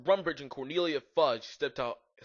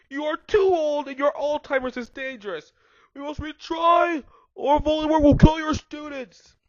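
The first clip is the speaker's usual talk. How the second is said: high shouted character voice, much louder; yelled; 14 semitones higher, 11 dB louder than usual. A narrator's reading. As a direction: shriek it like an outraged battle-axe. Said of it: "How you like my voice for her?".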